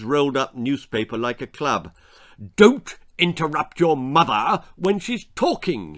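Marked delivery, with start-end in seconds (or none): 2.6-6.0 loud, raised angry growl; growling father. A narrator's reading. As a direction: growl a stern warning. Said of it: "he growled".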